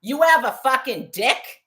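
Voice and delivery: high, loud, outraged question; angry challenge. Real, not acted. Woman confronting the harasser who insulted her, furious shout.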